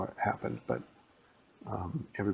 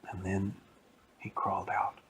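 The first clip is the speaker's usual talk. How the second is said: plain reading voice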